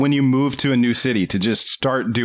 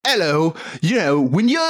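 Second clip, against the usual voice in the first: higher-pitched, hammed-up Cockney; mock Cockney; 4 semitones higher than usual. Imagining the film's hero as a Londoner, mock Cockney. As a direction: put on a silly Cockney accent. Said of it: "everything but Cockney".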